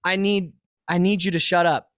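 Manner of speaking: ordinary delivery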